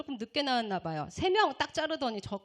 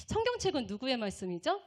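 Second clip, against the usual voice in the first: ordinary talk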